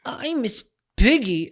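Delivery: high, slow pig diva voice; Miss Piggy. Acted. Host introduces the pig costume, high drawn-out puppet voice. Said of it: "We are Miss Piggy and Kermit the Frog".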